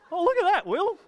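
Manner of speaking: sudden high excited exclamation; delighted surprise. Real, not acted. Preacher surprised by the slide, delighted. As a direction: gasp of delight at the picture.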